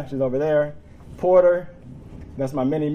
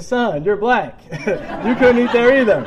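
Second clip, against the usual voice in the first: loud, higher retort breaking into laughter; amused exclamation; 10 semitones higher, 9 dB louder, slower than usual. Retold or imitated. Dad correcting his son about history, laughing exclamation. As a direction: hammer the punchline, then laugh through it.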